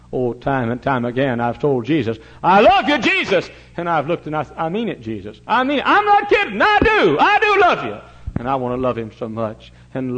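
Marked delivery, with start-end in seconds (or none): 2.4-3.5 high, loud cry of love to Jesus; fervent cry. Real, not acted. Preacher cries out his love. 5.5-8.0 very high, loud, pleading declaration; pleading insistence. Real, not acted. Insisting on his love for Jesus.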